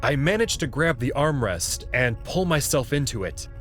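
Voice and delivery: usual speaking voice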